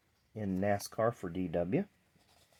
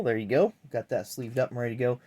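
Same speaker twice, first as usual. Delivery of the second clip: ordinary talk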